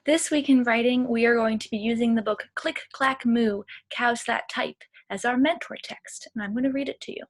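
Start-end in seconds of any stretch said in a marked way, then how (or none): none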